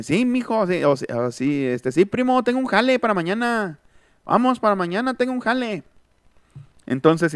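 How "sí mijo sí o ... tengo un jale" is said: a hint of a Monterrey accent, higher; lightly voiced northern buddy offering work; norteño speech. Monterrey guy tells his cousin he has a job.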